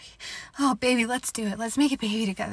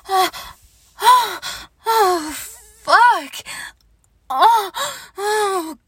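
high loud ecstatic cries; loud moans; 8 semitones higher, 8 dB louder, much slower than usual. Acted. To her partner during sex, crying out loudly. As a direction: cry out in pleasure.

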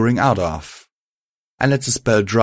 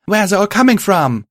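high, loud battle shout; shouted question; 12 semitones higher, 6 dB louder than usual. Acted. Violet back to back with her partner, shouted question. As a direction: demand it at the top of the voice. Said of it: "she shouted as we ended up".

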